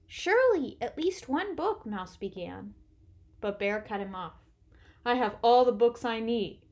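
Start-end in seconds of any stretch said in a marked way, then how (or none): 0.1-1.8 high, hopeful Mouse plea; pleading. A narrator's reading. Mouse pleads with departing Bear. 5.0-6.6 firm, somewhat raised Bear voice; certain. A narrator's reading. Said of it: "He looked quite certain".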